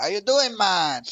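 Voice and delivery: hammed-up Jamaican troll hello, loud; mock Jamaican accent. Retelling his reply to a troll, loud mock Jamaican accent. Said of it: "I was like".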